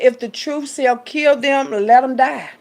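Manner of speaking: loud, slow scripture proclamation; fervent sermon quote; preaching. Acted. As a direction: proclaim it from the pulpit. Quoting scripture loudly, slow.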